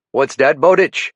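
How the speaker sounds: lieutenant calling out a warden, faint French-Canadian lilt; French-Canadian accent. Acted. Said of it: "His accent tended to make his T H's sound like D's".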